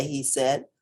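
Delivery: ordinary delivery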